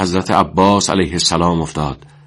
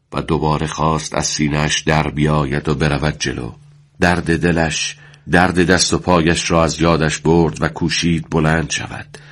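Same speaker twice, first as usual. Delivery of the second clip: plain reading voice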